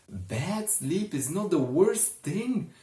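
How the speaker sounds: high, loud protest; indignant outburst. Acted. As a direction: throw the line back at her, upset. Richard protests loudly.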